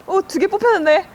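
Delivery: high, loud surprise; surprised. Real, not acted. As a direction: react with sudden surprise. Two slips drawn.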